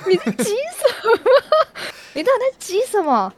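half-laughing mock bafflement; laughing at a coworker's frantic clicking; laughing exasperation. Exasperated inner quote with laughter.

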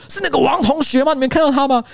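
high, heated, accusing questions; furious questioning. Retold or imitated. Streamer retelling his furious reply in the group chat, high and heated. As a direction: demand answers like you're ready to fight. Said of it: "我还超怒说".

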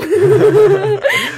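burst of laughter. Real, not acted. Laughing at lazy homeschool kid.

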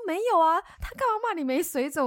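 high and loud, stunned; shocked bystanders. Retold or imitated. Voicing the shocked workers.

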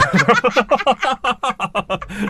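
big high burst of laughter; loud laugh. Real, not acted. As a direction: crack up laughing. Bursts out laughing after punchline.

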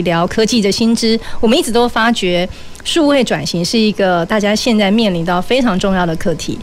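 ordinary talk

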